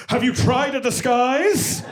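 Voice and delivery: high, loud, slow theatrical voice; hammed-up dad with a flourish; playwright dad. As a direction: offer it as a grand dramatic suggestion. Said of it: "my dad's like".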